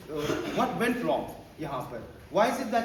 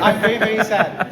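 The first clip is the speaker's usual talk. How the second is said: mock sad said loud; 3 semitones higher, 12 dB louder than usual. Shown as a demonstration. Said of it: "Now say".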